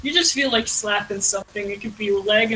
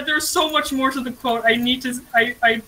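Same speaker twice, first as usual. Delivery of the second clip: ordinary talk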